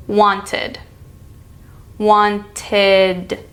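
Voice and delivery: "Wanted" ending in a light "id"; "Wanted" with the tongue tip on the roof of the mouth for "id". Shown as a demonstration. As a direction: end "Wanted" with an open "id". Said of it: "how to pronounce the ending, id".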